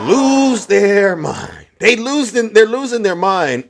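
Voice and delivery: slow and high, savoring it; gleeful mocking. Real, not acted. Streamer gloating over MAGA reactions, slow and high. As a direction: relish their meltdown.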